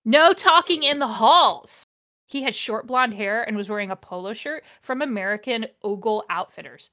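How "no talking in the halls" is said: loud, slow, higher teacher rebuke; mock stern scolding. A narrator's reading. Dumbledore scolding the goth students, slow and loud. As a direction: bark it down the hallway.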